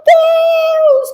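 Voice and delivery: high, drawn-out "my God" wail; long high-pitched exclamation, acting out mom; retold mother's cry. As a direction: imitate mom crying out, voice shooting way up. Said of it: "Começou a gritar".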